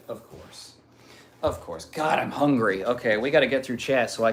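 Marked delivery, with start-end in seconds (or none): 0.1-0.7 hushed voiceless whisper; whispered aside. Acted. Streamer whispering a knowing aside to chat. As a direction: mutter it under your breath.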